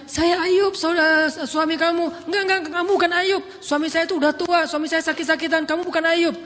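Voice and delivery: wife refusing to believe it's him; insistent denial. Retold or imitated. Ayub and wife arguing identity. (istri menolak percaya dengan suara tinggi)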